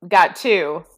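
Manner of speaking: loud, punched disbelief; loud incredulous challenge. Real, not acted. Challenging the co-host on mates being rare, loud and incredulous. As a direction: call out the nonsense at full volume.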